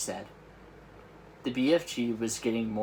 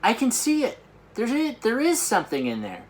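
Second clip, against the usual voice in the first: much higher, loud amazed cry; excited cry; 12 semitones higher, 5 dB louder than usual. Acted. Sophie spots the dream in the jar, amazed. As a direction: gasp it out in wonder. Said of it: "cried out".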